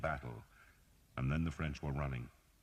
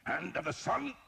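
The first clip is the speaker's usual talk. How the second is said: raised, fierce snarl. A narrator's reading. Priest breaking a nose, snarling. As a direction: spit it as he strikes. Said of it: "Father Hob snarled".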